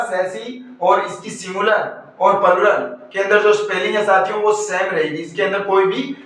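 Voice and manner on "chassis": सैसी said "SAS-ee", silent final s; correct pronunciation. Shown as a demonstration. Teacher giving students the correct word, pronounced "sas-ee". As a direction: say सैसी with soft opening s, no ch. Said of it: "correct pronunciation क्या होगा".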